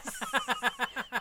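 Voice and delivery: high, loud laugh; burst of laughter. Real, not acted. Laugh at Fuller House.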